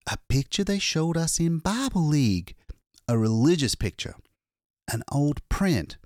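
a hunted man finally safe, still shaking; shaken exhausted man. Acted. Lois tells the attendant of his escape, frightened.

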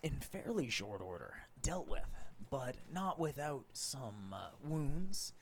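recap narration. Acted. Game master's recap continues.

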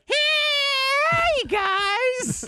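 very high squeaky friendly greeting; friendly shriek voice. Retold or imitated. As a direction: squeal it like a cheerful monster. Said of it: "Like, it's just like".

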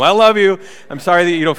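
called out loud and affectionate; loud i love you. Real, not acted. Pastor calling out to his wife at home, loud and fond. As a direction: call it out to her, big and fond.

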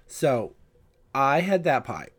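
ordinary talk, nothing marked

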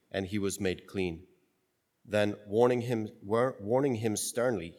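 measured, reverent liturgical reading; solemn gospel reading. Acted.

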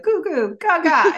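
put-on high cooing baby talk; baby babble. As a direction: coo it like a baby. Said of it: "she's gonna go like".

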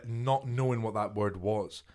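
usual speaking voice

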